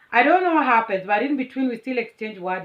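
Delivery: ordinary talk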